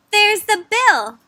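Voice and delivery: high, loud, slow insistence; emphatic storybook argument; pointing out proof. Acted. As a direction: show them the obvious, drawn out.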